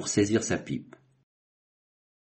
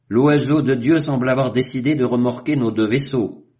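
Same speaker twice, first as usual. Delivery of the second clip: higher and louder, delighted; exclaiming; 6 semitones higher, 6 dB louder than usual. A narrator's reading. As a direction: proclaim it like a happy sign from God. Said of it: "s'exclama Duke".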